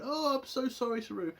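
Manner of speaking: hammed-up weepy sorry; mock teary-eyed apology. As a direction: imitate a tearful apology, mockingly. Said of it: "fucking teary-eyed going".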